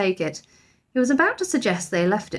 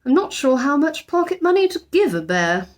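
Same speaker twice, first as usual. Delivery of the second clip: higher, wavering father voice; Mr Brown weakening; 7 semitones higher, 3 dB louder than usual. A narrator's reading. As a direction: give ground reluctantly.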